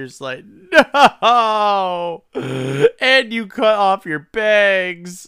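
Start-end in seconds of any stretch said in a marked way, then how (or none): 1.2-5.3 high, loud, slow wail; mock anguished cry. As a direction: wail it in hammed-up despair. Mock Freddie's despair.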